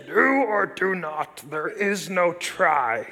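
low, slow Yoda voice, pausing between words; playful Yoda imitation, dropped low and drawn out. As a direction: croak it out slow like the little Jedi master. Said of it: "It's my Yoda impression".

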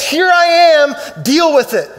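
slow, loud defiant proclamation; booming slow declaration; defiant shout. Acted. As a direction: let truth shout at you, unbending. Truth personified speaking defiantly.

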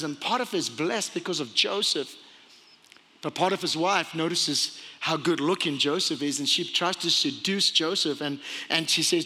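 ordinary delivery

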